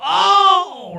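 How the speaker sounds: high, loud mock-angry wail; mad Ross. Host imitating Ross getting mad on Friends, mock angry yell. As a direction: blow up in a hammed-up sitcom rage. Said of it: "he gets mad and he goes".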